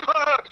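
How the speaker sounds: high startled "Ah!" yelp; retold startled yelp. Partner throwing hands on the roof, high startled yelp. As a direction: yelp high as if a gun was drawn on you. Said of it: "He goes".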